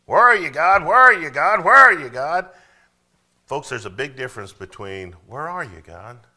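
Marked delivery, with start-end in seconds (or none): 0.0-2.5 high, loud, accusing cry at God; demanding complaint. Retold or imitated. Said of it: "different attitude". 5.3-6.2 sincere seeking. Shown as a demonstration. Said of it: "Same words, different attitude".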